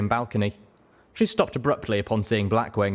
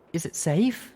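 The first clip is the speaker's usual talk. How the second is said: timid frightened question; 9 semitones higher, slower than usual. A narrator's reading. Frightened pony asks.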